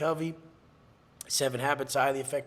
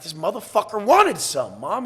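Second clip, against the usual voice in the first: louder, higher retold anger; retold pissed-off outburst; 5 semitones higher, 7 dB louder than usual. Son explaining his new case to Mom, pissed off. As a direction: punch it out, pissed, as in retelling. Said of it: "and I'm pissed".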